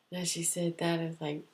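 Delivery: ordinary delivery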